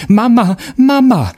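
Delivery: put-on high, loud child's cry for mother; a frightened boy yelling for mama; boy caught by the witch screams for his mother; boy screaming. A narrator's reading. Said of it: "schrie da der Junge".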